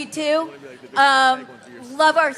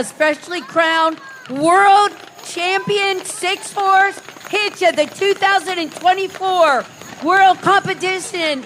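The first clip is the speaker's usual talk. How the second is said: animal show trainer; 3 semitones higher, 3 dB louder than usual. Acted. Parade announcer presents champion team.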